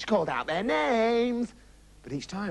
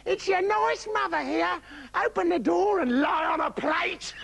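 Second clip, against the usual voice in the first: very high false-mother voice; wolf posing as mother, high and drawn out; wolf's fake nice voice; 10 semitones higher, slower than usual. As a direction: pose as their nice mother, pitched right up. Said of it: "practiced speaking with a nice voice".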